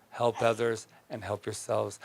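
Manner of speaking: gentle repeat. Shown as a demonstration.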